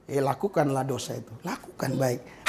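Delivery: low, soft, sweet-sounding permission; gentle-sounding tone. Shown as a demonstration. Preacher showing smooth words that excuse sin, soft and low. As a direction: say it softly, like a kind favour. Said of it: "Halus kan?".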